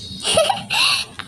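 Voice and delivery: a high squealing giggle. Acted. High laugh. (ऊँची खिलखिलाहट)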